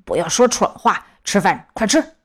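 marbles in mouth. A narrator's reading. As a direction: mouth full, mumbling. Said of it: "爸爸满嘴塞着饭， 口齿不清地说".

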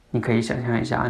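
ordinary talk, nothing marked

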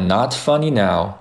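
"not funny now" taken at an unhurried pace; relaxed-tempo "not funny now". Shown as a demonstration. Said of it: "如果你读得比较慢".

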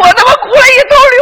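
high, loud, very fast exclamation; indignant outburst. Acted. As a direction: blurt it out outraged. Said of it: "我当时我就恼了". (气恼地高声快嚷)

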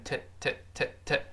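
"to" reduced to a short "tuh"; weakened to. Shown as a demonstration. Teacher showing fast-speech to, vowel weakened. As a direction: repeat a weak, schwa-like "tuh" for "t". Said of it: "那个to读快了边t".

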